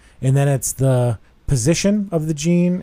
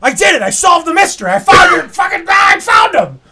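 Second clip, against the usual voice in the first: much higher and louder, shouting the news; excited; 8 dB louder than usual. Retold or imitated. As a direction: burst out with it like you just cracked the case. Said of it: "I call him all excited".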